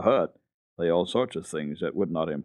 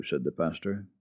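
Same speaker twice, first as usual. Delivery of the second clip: ordinary delivery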